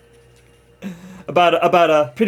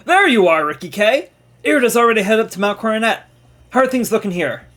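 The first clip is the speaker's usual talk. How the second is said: higher, louder character voice; game character greeting Ricky; 7 semitones higher, 3 dB louder than usual. Acted. Reads a game character's dialogue.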